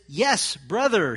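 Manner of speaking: slow, loud, stretched-out "Yes, brother"; emphatic reading. Shown as a demonstration. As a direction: lean hard into the greeting. Reading Paul's words aloud.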